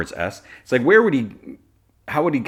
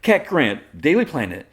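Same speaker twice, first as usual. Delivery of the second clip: loud, high mock on-mic introduction; showy reporter; 9 semitones higher, 6 dB louder than usual. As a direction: announce yourself like a showy reporter. Voices Cat announcing herself on microphone.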